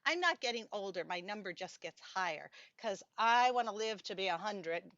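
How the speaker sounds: usual speaking voice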